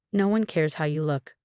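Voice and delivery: ordinary delivery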